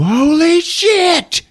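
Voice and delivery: high, drawn-out shocked yell; hammed-up cry of disbelief; mock shock. Wife seeing her missing husband, mock astonished shriek.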